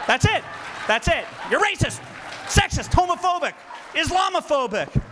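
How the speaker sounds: high, shouted mock accusations; uncontrolled outbursts. Speaker mocking the crowd's accusations, uncontrolled outbursts. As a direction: fire off labels like involuntary yelps. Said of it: "they're spastic and they have Tourette's".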